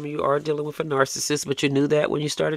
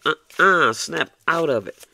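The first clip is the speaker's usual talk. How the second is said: scolding no; 3 semitones higher, 7 dB louder, slower than usual. Acted. Scolding a heartbroken listener.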